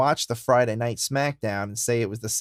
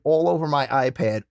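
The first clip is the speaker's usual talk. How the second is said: ordinary talk, nothing marked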